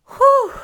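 loud high exhale of relief; relieved whew. Real, not acted. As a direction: blow it all out after the tears. Relief after emotional story.

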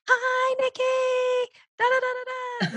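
very high, drawn-out singsong greeting; over-perky voicemail. Shown as a demonstration. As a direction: squeal it out slowly, absurdly chirpy. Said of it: "don't be like".

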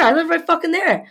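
high, exasperated rapid insistence; re-enacted indignant rant; retold heated retort. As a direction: argue back fast, pitch up high. Her side of retold quarrel.

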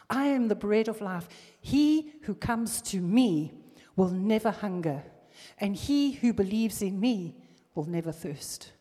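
loud, rising preacher's proclamation; preaching at full force. Acted. Preacher booming Jesus' promise to the congregation. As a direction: declare it to the whole church.

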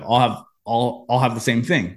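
halting nervous order, a touch unlike his own voice; nervous gulping hesitation. Retold or imitated. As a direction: stall over the words like you can't afford it. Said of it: "kinda took a big gulp".

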